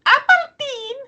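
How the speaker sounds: very high, put-on Scottish accent; caricatured Scottish voice, high-pitched. Shown as a demonstration. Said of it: "then I can do a Scottish accent".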